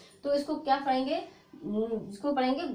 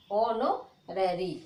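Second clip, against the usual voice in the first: usual speaking voice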